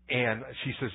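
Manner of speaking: normal talking voice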